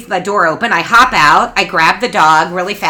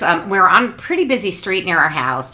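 ordinary talk

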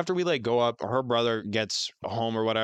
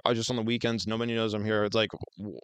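ordinary delivery

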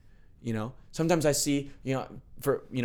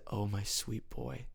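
slow, soft and much quieter; a soft, slow whisper of affection; tender, hushed; 10 dB quieter, much slower than usual. Retold or imitated. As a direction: speak it gently like a loving father. Voicing God's tender words.

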